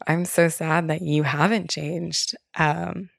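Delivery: usual speaking voice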